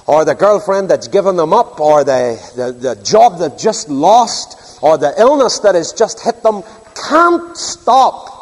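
ordinary talk

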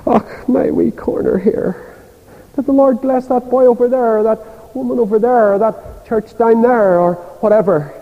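a hint of a jealous, sniffy church member; petty churchgoer. Church member begrudging others' blessing, mock sniffy grumble. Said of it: "people wouldn't know about it".

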